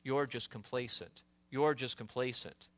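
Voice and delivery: soft, low whisper of the tempter; satanic whisper. Retold or imitated. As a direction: breathe it like the devil whispering in your ear. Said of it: "he comes and whispers".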